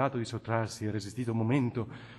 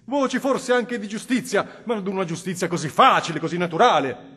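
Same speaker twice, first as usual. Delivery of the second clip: put-on impassioned old bishop exhorting, rising louder at the peaks; cardinal pleading; 5 semitones higher, 9 dB louder than usual. Cardinal Federigo urges the Innominato toward repentance, impassioned. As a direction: preach mercy to a hardened sinner with growing fire. Cardinal's long plea to sinner.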